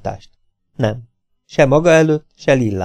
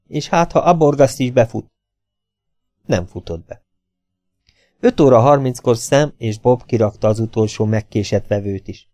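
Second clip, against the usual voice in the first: ordinary delivery, nothing marked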